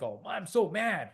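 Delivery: hammed-up angry outburst; mock mad. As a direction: drag out being mad, half-acted. Acting out expressing anger.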